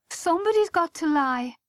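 a touch of wailing complaint; moaning. A narrator's reading. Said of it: "Missus Rooney moaned".

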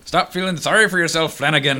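high, loud mock Irish pirate; Irish pirate accent. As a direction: put on a weird Irish pirate accent and call out. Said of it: "in this weird Irish pirate accent".

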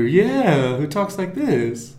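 high, slow cartoon-dog voice; lilting cartoon character drawl; Snagglepuss. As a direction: repeat the toon impression, drawn out. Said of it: "he's the cartoon character".

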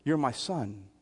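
low, hushed words into a son's ear; acted soft fatherly murmur; fatherly whispering. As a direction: say it very softly, as a private reassurance. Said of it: "why am I whispering into".

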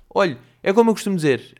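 lightly voiced chatty waiter; mock waiter. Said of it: "O senhor ouve é tipo".

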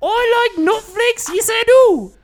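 very high, put-on Kiwi squeal; New Zealand-Australian accent. Kiwi guest plugs a cereal sponsor over a beat, high comic New Zealand-Australian accent. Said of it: "That's why my accent sounds the way it does".

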